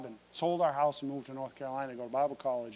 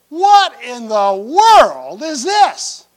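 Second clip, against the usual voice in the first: slow, loud, high outraged disbelief; shocked disbelief; 7 semitones higher, 16 dB louder, much slower than usual. Retold or imitated. Preacher staring at the hospital bill, slow loud disbelief. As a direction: stretch out every word in shock.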